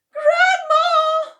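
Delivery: high, loud, tearful shriek of a panicking daughter. Acted. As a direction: scream her mother's name high and loud. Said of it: "shrieked Missus Cranky".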